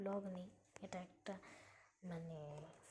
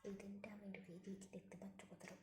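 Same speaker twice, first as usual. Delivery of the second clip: whispering; 8 dB louder than usual. Real, not acted. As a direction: keep it to a whisper while packing. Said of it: "আস্তে আস্তে বলছি".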